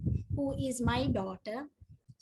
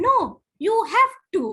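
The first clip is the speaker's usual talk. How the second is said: firm insistence; 7 semitones higher, 9 dB louder, much faster than usual. Retold or imitated. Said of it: "She said".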